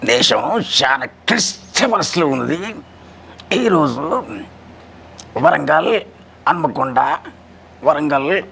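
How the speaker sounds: measured bulletin voice with long pauses; Nutan Prasad impression. Mimic as actor reads radio weather news, measured and halting. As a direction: announce it formally, lifting words then dropping. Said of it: "అదే నూతన్ ప్రసాద్ గారు".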